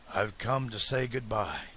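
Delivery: soft, subdued farewell; detached farewell. A narrator's reading. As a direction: say it quietly, as if someone else were talking. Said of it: "sounded as if somebody else was talking".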